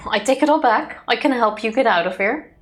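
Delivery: normal talking voice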